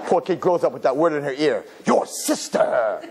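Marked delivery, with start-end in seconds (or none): 1.8-3.0 very slow, stretched echo; drawn-out taunt. Acted. Word ringing in the poor kid's ear, drawn-out taunt. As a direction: drag out both words.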